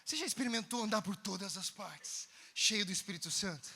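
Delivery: slow preaching cadence; slow sermon. Acted. Preacher exhorting congregation, slow reverent cadence.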